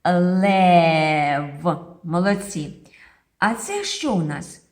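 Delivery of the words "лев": "лев" with a long, loud held "е"; intoned "е". Shown as a demonstration. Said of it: "інтонуємо цей звук". (протяжне «е» в слові «лев»)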